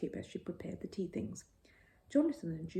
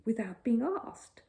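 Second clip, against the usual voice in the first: slow, slightly higher disbelief; 5 semitones higher, 4 dB louder, slower than usual. A narrator's reading. Judy teasing Paddington about his bath, slow. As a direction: draw it out in mock wonder. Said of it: "repeated Judy slowly".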